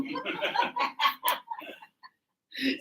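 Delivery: a burst of high giggling; high giggle. Real, not acted. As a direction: giggle at your own joke. Laughing at own joke.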